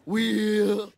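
usual speaking voice

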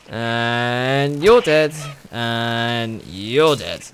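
slow, deadpan pronouncement; drawn-out mock finality. Gamer finishing off a creature, slow mock verdict. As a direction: stretch it out like a verdict.

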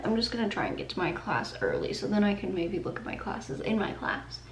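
ordinary delivery

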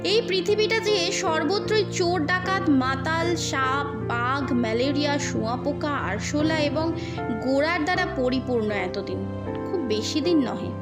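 ordinary delivery

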